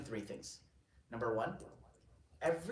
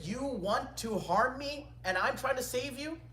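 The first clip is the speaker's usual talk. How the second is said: high, drawn-out disbelief; slow, raised-pitch reproach; indignant protest; 11 semitones higher, 4 dB louder, slower than usual. Retold or imitated. Scholar refuses the caliph's gold, indignant protest.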